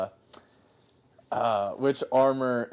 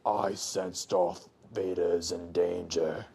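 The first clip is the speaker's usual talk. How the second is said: low, very slow, drawn-out villain impression; Emperor; 6 semitones lower, 6 dB quieter, much slower than usual. Emperor senses his apprentice in danger, low and ominous. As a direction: intone it low, stretching every word. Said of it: "there was a scene, he was like".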